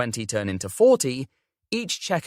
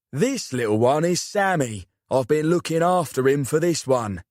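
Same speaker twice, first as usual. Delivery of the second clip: put-on South London accent; 3 semitones higher than usual. A narrator's reading. Uncle voiced in story.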